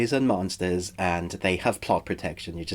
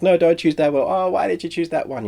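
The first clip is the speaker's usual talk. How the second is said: exasperated viewer; 8 semitones higher, 4 dB louder than usual. Pretend. Viewer shouting at choices.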